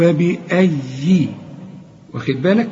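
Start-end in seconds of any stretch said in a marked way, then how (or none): 0.0-1.3 فبأي slow with a doubled, stressed yaa; tajweed demo. Shown as a demonstration. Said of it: "شوف".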